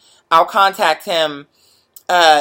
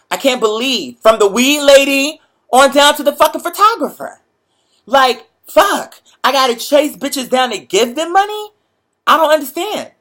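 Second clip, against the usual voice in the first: pitched-up frustrated outburst; frustrated rant; 6 semitones higher than usual. Retold or imitated. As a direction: vent like you're fed up with chasing people. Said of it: "I was just very frustrated".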